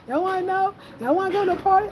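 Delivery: high, playful mom voice; mother dangling a party in front of her kids; mom teasing. Retold or imitated. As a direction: tempt the kids like a cool mom. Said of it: "My mama took us".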